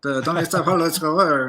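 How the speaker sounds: mumbled Medellín campesino Spanish, hardly opening the mouth; a Medellín farmer's accent, lightly imitated. DEA agent imitates a Medellín campesino, mumbled Medellín accent. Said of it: "he's like".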